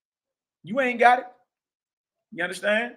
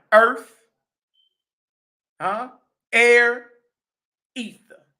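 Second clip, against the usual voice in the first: ordinary talk, nothing marked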